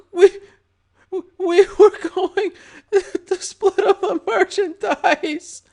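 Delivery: high, slow, sobbing stutter; stuttering from fear. A narrator's reading. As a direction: stammer it out through terror. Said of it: "Butterbread cried, stuttering from fear".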